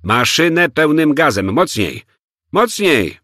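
high, urgent shouted command; building to a high yell on the last word; loud order. A narrator's reading. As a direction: shout to the driver to floor it. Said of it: "dodał głośno".

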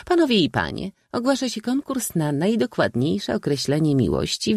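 put-on high, ringing proclamation by an old maid; stentorian announcer. A narrator's reading. Old maid announcing a contest from a bench, stentorian proclamation. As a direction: announce it formally, pitched well up. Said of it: "stentorowym głosem oznajmiła".

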